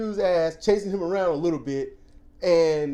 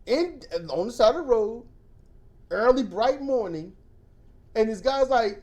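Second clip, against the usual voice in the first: normal talking voice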